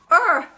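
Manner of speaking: loud, high "urgh" of self-annoyance; exasperated groan. Acted. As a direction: groan at your own slip. Exasperated groan at her shopping slip.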